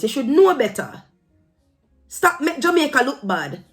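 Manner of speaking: normal talking voice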